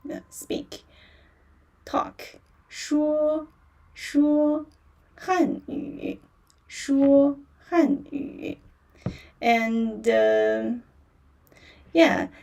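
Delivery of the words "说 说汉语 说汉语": 说 held high and level, first tone, then 说汉语 slowly; first tone demo. Shown as a demonstration. Teacher drills 说 first tone for students, held high. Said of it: "说 first tone".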